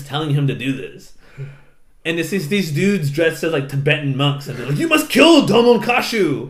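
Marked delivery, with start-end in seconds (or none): 4.8-6.5 high, loud chanted-style order; hammed-up monk decree; mock commanding monk. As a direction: intone it as a theatrical command. Monks give solemn order.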